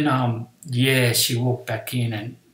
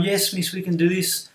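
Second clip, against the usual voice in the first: higher-pitched imitation of keen pupils; retold schoolkid chorus, pitched up; eager pupils; 6 semitones higher than usual. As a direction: lightly mimic a class rising to a challenge. Class eagerly answers teacher.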